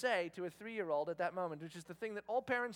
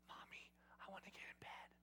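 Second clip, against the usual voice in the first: imitating a little kid whispering; child's whisper; 21 dB quieter than usual. Dad imitating daughter at mom's bedside, child's whisper. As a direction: whisper it softly, like a sneaking small child.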